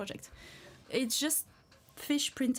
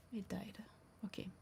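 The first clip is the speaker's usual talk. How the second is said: whisper; 14 dB quieter than usual. Real, not acted. Music stopped on the stream.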